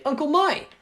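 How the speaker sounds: high, loud call to an uncle; excited greeting. Acted. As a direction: call out happily to greet a relative. Bakugo greets his uncle.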